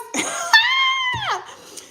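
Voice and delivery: long, very high squeal of laughter; high loud shriek. Acted. Whoop after spirit's surprise.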